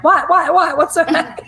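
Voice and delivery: high, loud, rapid-fire whats; acted-out burning curiosity; eager questioning. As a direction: demand to know, half laughing. Eager reaction to withheld secret.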